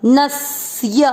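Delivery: नस्य said slowly, स joined to न; half स joined. Shown as a demonstration. Teacher showing the half स in नस्य. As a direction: draw out न-स्य slowly. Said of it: "न के साथ आधा सा नस्य".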